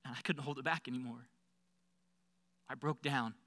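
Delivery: plain reading voice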